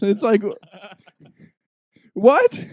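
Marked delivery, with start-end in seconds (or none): 2.1-2.6 high rising disbelief; mock stunned what; squeaky baffled question; incredulous. Retelling disbelief at no lettuce.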